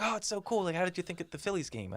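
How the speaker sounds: high excited gushing fans; gushing bar patrons. Retold or imitated. Bar crowd gushing at the fake Englishman, high and excited. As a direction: gush over him like starstruck locals.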